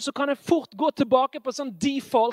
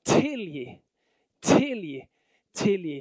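crying out forgive; 4 semitones lower than usual. Retold or imitated. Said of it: "Det roper".

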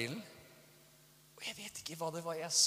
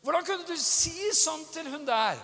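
high, loud indignant question; outraged scolding; 12 semitones higher, 7 dB louder than usual. Acted. Spouse confronting the other over harsh words, outraged scolding. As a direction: demand an explanation, pitched way up.

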